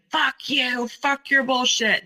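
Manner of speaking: high mock scream of rage; retold scream. Ex-fiancé screaming at her during a political argument, mock yell. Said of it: "he was screaming".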